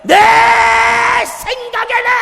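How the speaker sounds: high, loud, hammed-up huffy quote; angry outburst. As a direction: act out Naaman losing his temper. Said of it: "성질을 막 내면서".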